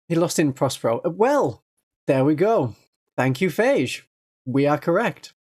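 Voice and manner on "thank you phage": usual speaking voice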